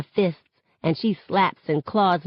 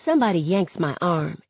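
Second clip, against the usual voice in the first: ordinary delivery, nothing marked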